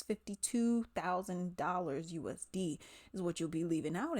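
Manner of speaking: ordinary talk, nothing marked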